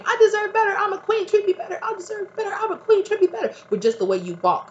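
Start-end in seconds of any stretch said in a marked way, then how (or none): 0.0-3.5 high-pitched hammed-up yell of demands; mock scream. Mocking the old self screaming at people. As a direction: shriek it like a queen fed up. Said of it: "where you don't have to scream".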